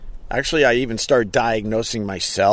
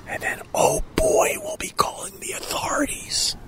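whisper; 5 dB quieter than usual. Acted. Gossiping about a neighbor passing by.

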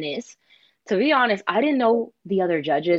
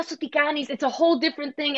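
ordinary talk

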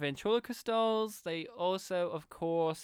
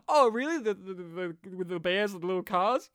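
Carl's surprised voice; 5 dB louder than usual. Retold or imitated. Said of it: "And Carl's like".

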